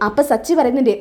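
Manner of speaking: usual speaking voice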